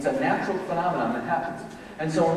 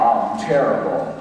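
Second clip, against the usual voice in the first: low, slow, deadpan misery; gloomy reply; 9 semitones lower, slower than usual. Pretend. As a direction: drag the words down like a sad sack. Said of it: "Or go".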